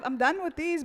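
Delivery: ordinary talk, nothing marked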